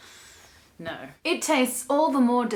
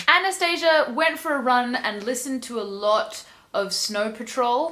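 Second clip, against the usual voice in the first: ordinary talk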